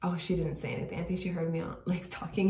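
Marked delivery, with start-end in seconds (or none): none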